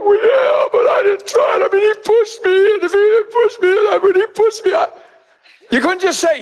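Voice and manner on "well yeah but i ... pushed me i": very high-pitched mock-tearful wail; hammed-up little-kid whine, frantic to shift blame; whining child excuse. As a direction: blubber out excuses like a cornered kid. Child makes tearful excuses, acted.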